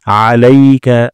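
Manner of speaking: আলাইকা with the আ from mid-throat; slow recitation. Shown as a demonstration. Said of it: "আইন গলার মাঝখান থেকে". (গলার মাঝখান থেকে আলাইকা)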